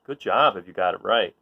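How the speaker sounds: ordinary delivery, nothing marked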